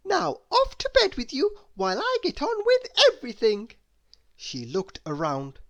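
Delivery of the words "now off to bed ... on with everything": much higher, bossy little helper voice; busybody helper. Acted. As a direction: shoo him to bed like a fussy nanny. Miss Helpful bossing him.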